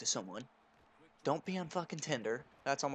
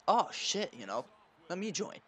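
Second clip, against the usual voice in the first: eager reply; 6 semitones higher, 3 dB louder than usual. Retold or imitated. Eager flirty reply to bubble bath.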